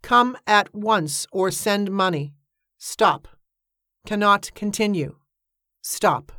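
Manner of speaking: slow, measured dictation with separated words; telegram read-out. A narrator's reading. Narrator reads out an urgent telegram, slowly and deliberately. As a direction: read out each phrase like a wired message.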